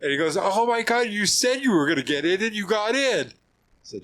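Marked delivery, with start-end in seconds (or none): 0.4-3.3 imitating a marvelling friend, much higher; thrilled he made it in; amazed delight. Retold Paulo marvelling.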